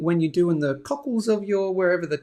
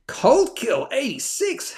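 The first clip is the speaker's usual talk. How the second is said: high, slow, drawn-out announcement; showy name call-out; 8 semitones higher, slower than usual. Pretend. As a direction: hail a newcomer with a playful flourish. Announcing a new viewer's name.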